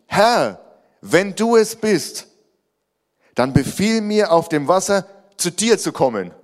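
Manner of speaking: high, loud call across water. Acted. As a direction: call up boldly to your Lord. Peter calls to Jesus on water.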